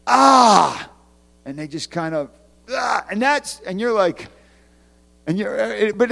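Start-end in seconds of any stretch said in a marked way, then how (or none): 0.1-0.9 loud, high anguished cry; frustrated cry. Retold or imitated. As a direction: let out a cry of despair.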